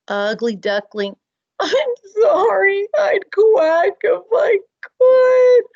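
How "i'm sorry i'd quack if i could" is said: high, slow tearful plea; crying plea. Acted. Ugly duckling apologizing to mother through tears. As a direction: beg through tears, higher and slower.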